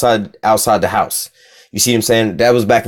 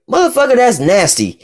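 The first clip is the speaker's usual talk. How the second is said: sharp jump much higher, mock disgust; disgusted retort; 11 semitones higher than usual. Answering people's excuses, high-pitched disgusted retort. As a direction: spit out the disgust. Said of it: "I'm like".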